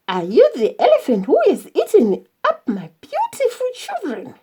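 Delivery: high, brisk, indignant mother's demand; Nanana, angry demand. A narrator's reading. Mother confronting the elephant about her children, angry demand. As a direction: demand it sharply, pitch leaping up. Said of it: "Nanana stood angrily in front of him".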